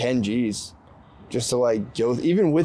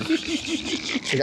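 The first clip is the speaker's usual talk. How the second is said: high mock wife voice; 4 dB quieter than usual.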